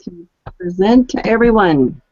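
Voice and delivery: ordinary talk, nothing marked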